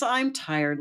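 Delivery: tired frog complaint. Acted. Frog tells the princess he wants bed, high complaining voice.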